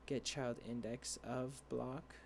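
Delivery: ordinary talk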